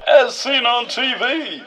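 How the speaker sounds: high, slow TV-ad voice; infomercial voice. Retold or imitated. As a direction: sell it like a late-night ad. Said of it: "where you go".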